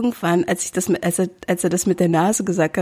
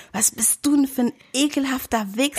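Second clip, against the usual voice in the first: mock yell; 6 semitones higher than usual. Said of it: "Hab geschrien".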